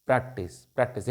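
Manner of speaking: Practice repeated softly, each falling; soft, pressing practice, practice; soft insistent repetition. Shown as a demonstration. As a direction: drive home practice quietly, twice. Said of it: "practice करना है।".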